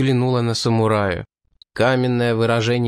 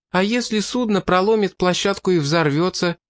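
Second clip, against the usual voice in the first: objecting; 7 semitones higher than usual. A narrator's reading. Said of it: "возразила Олис".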